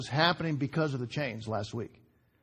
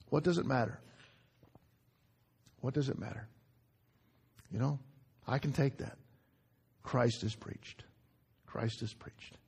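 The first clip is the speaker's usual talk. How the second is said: softly, lower, as if to yourself; quiet acceptance; 5 semitones lower, 8 dB quieter than usual. Acted. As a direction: let it settle in a low, subdued voice. Paul quietly accepting being wronged.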